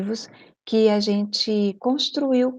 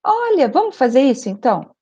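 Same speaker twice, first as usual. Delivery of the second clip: bright agreeing tone; 9 semitones higher, 4 dB louder than usual. Retold or imitated. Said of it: "Eu falei assim".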